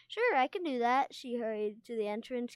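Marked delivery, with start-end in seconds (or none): 0.1-1.1 brightened. A narrator's reading. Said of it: "the small gold dragon brightened".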